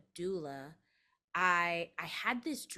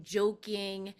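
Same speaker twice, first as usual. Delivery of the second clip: ordinary talk, nothing marked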